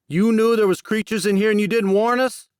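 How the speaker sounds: enraged hunter yelling at his leader; furious accusation. Acted. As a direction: shout it in his face. Jackson lifts Max, furious.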